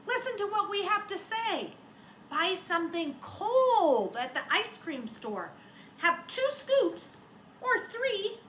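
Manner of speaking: put-on loud, high kids' shout; children yelling advice at the snowman. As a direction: shout it like excited kids. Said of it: "The children yelled".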